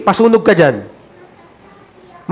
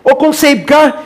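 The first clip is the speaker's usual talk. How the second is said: usual speaking voice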